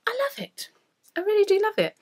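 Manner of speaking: thrilled, rising high and loud; delighted. Real, not acted. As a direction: gush about it with real joy. Delighted with her finished wrap.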